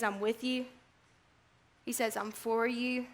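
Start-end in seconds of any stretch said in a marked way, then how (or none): 0.0-0.7 very soft, low and tender; hushed, caring promise; gentle reassurance. Retold or imitated. Preacher voices Jesus' reassurance. 2.2-3.1 hushed and caring; gentle reassurance. Retold or imitated. Preacher voices Jesus' reassurance.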